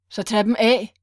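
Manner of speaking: loud impatient order; roaring command. Acted. Kidnapper orders the crying woman to take off her shoes, roaring. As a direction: roar it down at her. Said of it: "kommanderede han brølende".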